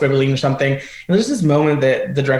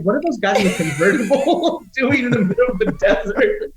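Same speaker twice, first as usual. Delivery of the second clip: high-pitched, laughing through the words; 7 semitones higher than usual. Real, not acted. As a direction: crack up while asking in disbelief. Cracking up at the absurd scene.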